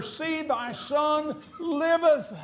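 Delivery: See, thy son liveth pitched high and slow; joyful triumphant announcement. Acted. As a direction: announce it with wonder. Prophet proclaiming the child lives.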